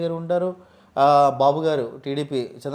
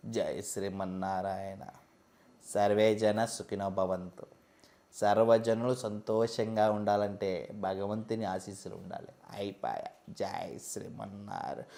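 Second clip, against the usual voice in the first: low, soft, slow chanting blessing; devotional guru impression; 8 semitones lower, 10 dB quieter, slower than usual. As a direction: intone it quietly like a swami. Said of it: "డివోషనల్గా కొంతమందిని తీసుకొద్దాం".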